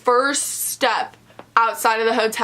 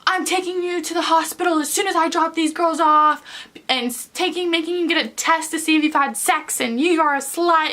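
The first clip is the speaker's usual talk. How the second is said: imitating screaming; 6 semitones higher than usual. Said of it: "Samantha's mom's screaming at her".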